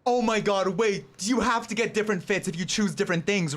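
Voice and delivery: normal talking voice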